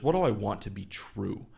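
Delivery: ordinary talk, nothing marked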